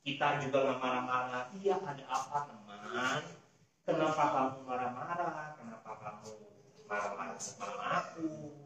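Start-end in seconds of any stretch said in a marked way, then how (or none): 1.6-8.5 low, soft, calming answer; hushed and mild, pitched down; gentle, soft reply. Acted. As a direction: answer an angry friend gently. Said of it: "kita jawabnya lemah lembut".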